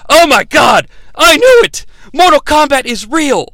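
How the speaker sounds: high, loud hammed-up amazement; imitated breakthrough shout; mock excited outburst. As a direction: cry out as if proven right at last. Acts Sonya's amazed discovery in temple.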